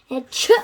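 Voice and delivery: a sudden loud sneeze bursting out. Acted. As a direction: let the sneeze explode. Sneeze cuts off his word.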